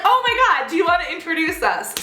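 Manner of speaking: high squealing gush; fake gushing. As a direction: gush at him to get the selfie. Sucking up to guy.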